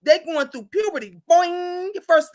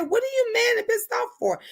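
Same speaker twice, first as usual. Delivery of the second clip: high-pitched retold questioning; mock-incredulous question, pitched well up; incredulous retort; 9 semitones higher than usual. Retelling her reply to an upset friend, incredulous.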